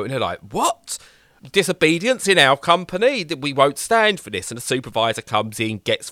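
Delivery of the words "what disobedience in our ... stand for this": high, indignant company boss; hammed-up indignation; mock outrage. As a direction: play a scandalised executive. Bosses outraged at intern's refusal.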